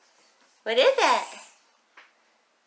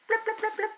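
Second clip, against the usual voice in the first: fish bloop; 11 semitones higher, 8 dB quieter, faster than usual. Shown as a demonstration. Fish sound for toddler.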